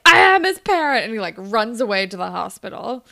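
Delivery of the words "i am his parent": higher, much louder firm shout; mock firm shout; firm declaration. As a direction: assert it fiercely. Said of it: "And Dallas is like".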